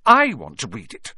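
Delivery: loud, angry insistence on I; furious. A narrator's reading. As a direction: fire back furiously at a rival. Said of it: "said Harry furiously".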